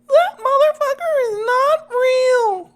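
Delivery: very high, drawn-out shriek, loud; loud, slow, piercing mimicry of a woman; screeching high-pitched impression. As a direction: screech it way up at the top of your voice. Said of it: "the pitch you hit was".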